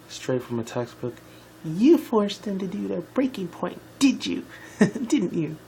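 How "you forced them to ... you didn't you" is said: accusing character voice. Acted. Twilight accusing the queen.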